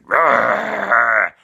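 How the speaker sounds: loud storybook bear roar; long, loud growling roar. Acted. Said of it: "And he went".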